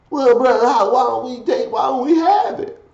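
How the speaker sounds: high-pitched mock objection; questioning listener. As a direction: pitch it way up as a puzzled follower asking why.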